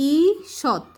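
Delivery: ঈষৎ slowly, long "eee... shot"; slow teacher's "ee-shot"; sounded-out word. Shown as a demonstration. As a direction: stretch the first vowel of ঈষৎ. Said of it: "দীর্ঘির উচ্চারণটা কি হবে".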